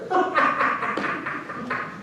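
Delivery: high, soft acted cackle; mocking laughter. Men hearing the vaquero's boast, acted high laughing.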